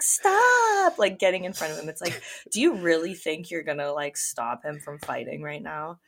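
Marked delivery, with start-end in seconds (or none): 0.0-1.0 very high, loud drawn-out cry; mock desperate yell. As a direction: imitate someone shrieking to halt a fight. Acts Sammy trying to stop Ronnie.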